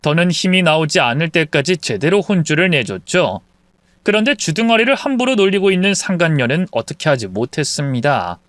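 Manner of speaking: plain reading voice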